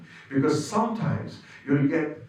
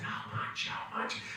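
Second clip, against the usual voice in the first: whispered; 12 dB quieter, slower than usual. Retold or imitated. Wife asking anxiously at 4am.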